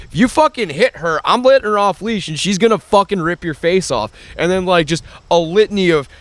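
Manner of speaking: unload on them without a breath; retold angry rant. Ranting at the teenage kids.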